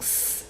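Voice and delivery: soft unvoiced sss; snake hiss. Shown as a demonstration. Teacher illustrates cédille for learners, snake-like hiss. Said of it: "comme un serpent".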